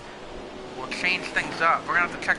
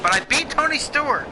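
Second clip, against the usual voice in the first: excited; 6 semitones higher than usual. Real, not acted. Gloating after beating Tony Stewart.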